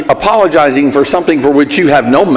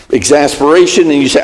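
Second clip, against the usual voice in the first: normal talking voice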